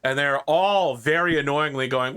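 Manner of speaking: ordinary talk, nothing marked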